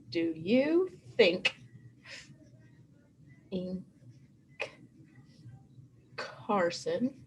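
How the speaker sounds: each word drawn out slowly as if writing it; slow dictation. Shown as a demonstration. Teacher dictating the sentence to her class, slow. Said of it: "I'm gonna write it down here".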